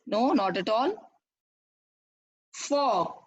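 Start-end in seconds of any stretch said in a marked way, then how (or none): 2.5-3.2 "Fog" as one isolated beat; single-beat word. Shown as a demonstration. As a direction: say "Fog" in a single firm pulse, standing alone. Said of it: "With a single beat".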